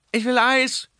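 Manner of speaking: put-on very high delighted child, stress on "Eis"; happy boy clapping, "Eis" sung out high; gleeful child's shout. A narrator's reading. Boy cheers for his ice cream, gleeful and high. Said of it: "ruft Pepe und klatscht vergnügt".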